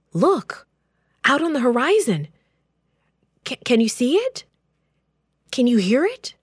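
high, loud, urgent calls across the camp; breathless lookout shouting the news; alarmed call. Acted. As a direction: raise the alarm, pointing out to the horizon. Messenger spots army, alarmed.

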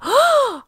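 high, loud gasp of astonishment; sharp gasp. Acted. The crowd gasping as Lazarus comes out, sharp and high. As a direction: gasp in shock. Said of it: "Everybody gasped".

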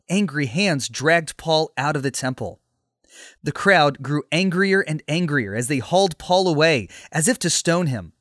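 ordinary delivery, nothing marked

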